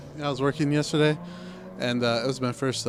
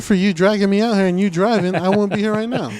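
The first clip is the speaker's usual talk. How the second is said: loud, high-pitched complaint; exasperated protest; 7 semitones higher, 8 dB louder than usual. Real, not acted. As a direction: push back hard at the friend who dragged you out. Tired, blames friend for dragging him out.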